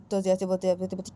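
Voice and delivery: ordinary delivery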